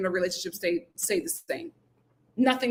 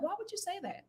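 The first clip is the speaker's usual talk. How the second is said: stunned disbelief. Retold or imitated. Recalling pausing the episode, high incredulous. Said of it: "I was like".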